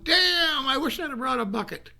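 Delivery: put-on high, loud surprised exclamation from a thrilled five-year-old; excited little boy. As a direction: blurt it out like a kid who found treasure. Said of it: "I'm going".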